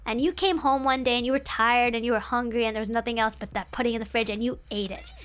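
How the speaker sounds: ordinary talk, nothing marked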